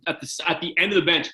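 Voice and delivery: ordinary delivery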